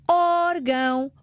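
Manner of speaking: "Órgão" stressed on the first O, said slowly; slow, careful "Órgão" with the weight on Ó-. Shown as a demonstration. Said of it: "Em órgão, acento no O".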